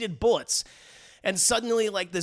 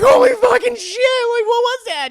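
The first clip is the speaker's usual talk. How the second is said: much higher, louder amazement; stunned shout; 13 dB louder than usual. Retold or imitated. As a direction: yell it in total shock. Friends stunned after explosion.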